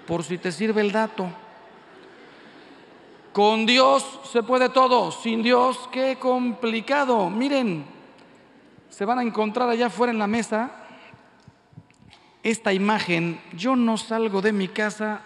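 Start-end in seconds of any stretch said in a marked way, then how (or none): 3.3-10.2 preaching at full force. Real, not acted. Preaching climax.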